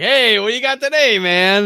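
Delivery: loud, teasing hey-man voice; hey-man heckler. Acted. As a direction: call it out to pester him. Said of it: "being like".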